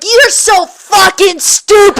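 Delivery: high, loud, slow shouted scold; mock angry yell. Imitating her mom yelling at her in the glass. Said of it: "You're like".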